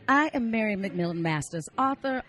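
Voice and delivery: high, bright self-help pitch; a prim coach hamming up her ad; mock ad read. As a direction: sell the book like a proper life coach. Parody ad read by life coach.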